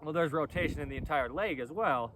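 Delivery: ordinary delivery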